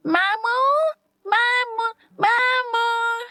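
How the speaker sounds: put-on small child's high voice calling out to his uncle; a little kid running up, calling happily; little boy calling. A narrator's reading. Little Viraj runs to his uncle, high excited child's call. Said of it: "भागता हुआ विराज आकर".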